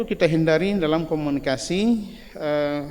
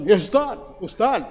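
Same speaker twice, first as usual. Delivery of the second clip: "Ya Ustaz, Ustaz" higher and louder, sharp raised angry address; high-pitched angry call; 5 semitones higher, 5 dB louder than usual. Retold or imitated. Angry Egyptian calling the teacher, loud and high. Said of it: "suaranya tinggi".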